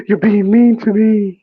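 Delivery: high, loud, drawn-out whine; whiny, hammed-up sobbing complaint; mock crying. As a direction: imitate a tearful wife wailing slowly. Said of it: "she started to cry".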